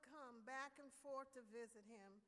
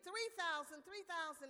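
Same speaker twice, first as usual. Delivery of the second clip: loud, higher auctioneer call; 4 semitones higher, 9 dB louder than usual. A narrator's reading. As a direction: cry out the opening bid to the room. Auctioneer calling bids.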